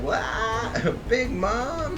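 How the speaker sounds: high, startled yelp with a laugh in it; shocked cry. Retold or imitated. Kaido's forces seeing Big Mom smashed, with a high shocked yelp. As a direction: yelp like panicked henchmen. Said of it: "Kaido's forces are shocked".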